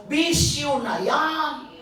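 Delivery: slow, loud stress on bisyo; slow emphatic punchline. Acted. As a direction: drive bisyo home slowly. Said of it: "preaching punchline".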